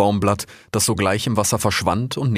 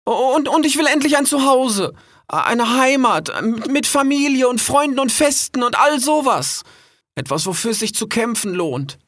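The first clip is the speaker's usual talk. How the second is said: put-on much higher, stammering young fox; high pleading fox; 3 dB louder than usual.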